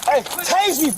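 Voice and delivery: high, defiant taunting shouts. Real, not acted. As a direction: dare them, riled up and mocking. Suspect taunting officers.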